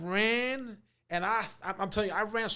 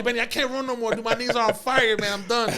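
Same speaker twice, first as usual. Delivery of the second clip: loud, raised mock plea; exhausted protest; 6 semitones higher, 8 dB louder than usual. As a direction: give up loudly, complaining to Benny. Acted plea to agent, giving up.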